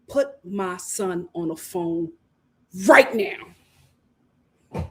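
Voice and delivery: slow, measured demand; mock stern mom on the phone; stern mother. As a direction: lay down the law to production, slowly. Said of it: "If that was my son".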